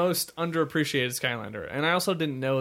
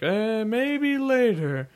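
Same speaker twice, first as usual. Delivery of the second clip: slow, higher, dismissive mom; brushing off; 8 semitones higher, 3 dB louder, much slower than usual. Retold or imitated. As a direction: mom putting off her kid. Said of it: "the mom was like".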